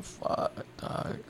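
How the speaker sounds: breathy whisper under the breath; whispered aside. Retold or imitated. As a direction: whisper it like a secret curse. Reaction to her demand again.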